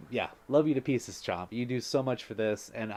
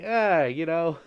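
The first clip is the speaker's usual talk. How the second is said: lightly hammed-up dad, louder and higher; hearty mock-dad praise; 5 semitones higher, 6 dB louder, slower than usual. Streamer playing dad punching kid's shoulder, lightly hammed-up dad voice. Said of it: "That's my dad voice".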